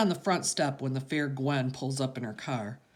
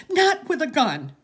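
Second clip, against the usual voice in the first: much higher and louder, shrieking protest; tearful yell; 14 semitones higher, 10 dB louder than usual. Acted. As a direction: yell at him to stop, close to tears. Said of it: "Graham yells".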